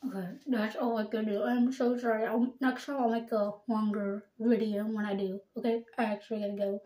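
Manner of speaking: talking around toothbrush; toothbrush in mouth. Real, not acted. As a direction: teeth clamped on brush. Seen 4: Toothbrush handle sticks out of her mouth in every labelled frame.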